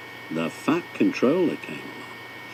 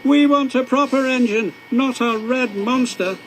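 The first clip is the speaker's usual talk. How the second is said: high, louder, scornful truck chorus; jeering trucks; 13 semitones higher, 5 dB louder than usual. A narrator's reading. Trucks insulting James, high and jeering. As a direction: jeer at him like spiteful trucks.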